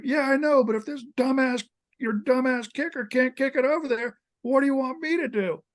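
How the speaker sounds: high-pitched, exasperated arguing back; retold protest, pitched well up; exasperated retort. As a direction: imitate a coach defending himself in an argument. Retold coach arguing back when yelled at.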